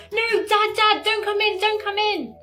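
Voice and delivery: high-pitched mock panic; freaking out. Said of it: "he starts freaking out".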